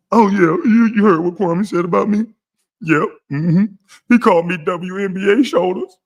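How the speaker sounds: high, nasal-free whining put-on voice; mocking a man complaining about an insult; mock whiny caller. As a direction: tattle to your goon like a hurt kid. Acts complaining caller on phone.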